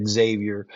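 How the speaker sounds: "Xavier" said as "ex-ZAY-vee-er"; mispronounced Xavier. Retold or imitated. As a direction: say "Xavier" with an extra "ex" in front. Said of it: "that's the wrong way to say".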